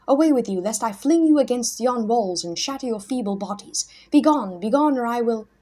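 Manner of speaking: loud, high raging threat; furious threat. A narrator's reading. Mad hermit threatening to shatter the boys. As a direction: drive them out with fury.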